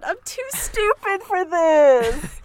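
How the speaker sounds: high, drawn-out comic whine; mock despair. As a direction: wail it slowly in hammed-up helplessness. Joking despair at artificer notes.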